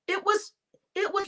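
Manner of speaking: ordinary talk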